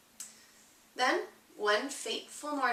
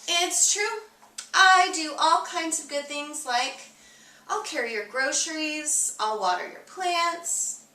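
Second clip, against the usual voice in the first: higher, proud helper voice, louder on the list; eager good egg; 6 semitones higher, 3 dB louder than usual. A narrator's reading. Good egg boasting to the reader, proud and higher. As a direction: sell every good deed like a proud little egg.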